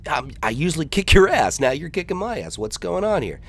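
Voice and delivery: lightly voiced puzzled Rick; baffled kid. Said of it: "He's like".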